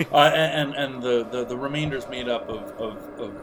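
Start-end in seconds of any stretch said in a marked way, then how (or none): none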